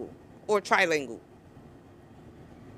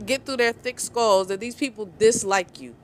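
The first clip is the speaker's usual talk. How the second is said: ordinary delivery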